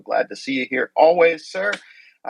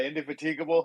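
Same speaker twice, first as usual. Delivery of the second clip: usual speaking voice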